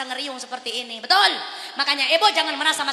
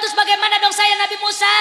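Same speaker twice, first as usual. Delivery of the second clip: loud, high plea; anxious shout; 6 semitones higher, 8 dB louder than usual. Acted. Muhammad pleading to Moses with a loud high shout. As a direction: cry out asking what to do. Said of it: "kata Nabi Muhammad".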